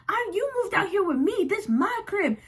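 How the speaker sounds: put-on high, raging girlfriend voice; furious girlfriend. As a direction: mimic her ranting at the boyfriend. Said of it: "She's like".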